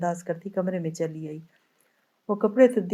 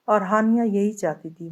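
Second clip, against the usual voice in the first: ordinary delivery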